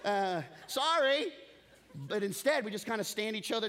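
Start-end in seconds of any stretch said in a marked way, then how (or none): 0.1-1.3 mock sheepish sorry. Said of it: "both of us would go".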